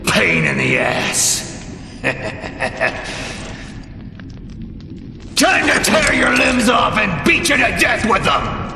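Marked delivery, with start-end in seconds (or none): 1.5-5.3 low-volume, menacing chuckle; villain's chuckle. Acted. Villain laughs at Grave before the kill, quiet chuckle. 5.4-8.7 high, furious battle shout; shouted threat. Acted. Villain threatens to tear Grave apart, shouted threat.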